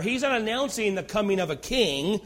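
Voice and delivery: ordinary talk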